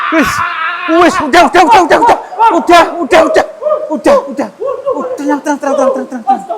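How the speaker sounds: very high, loud panicked repeats; frantic pleading. Real, not acted. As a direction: beg it to stop, half laughing. Frantically calming the spirit.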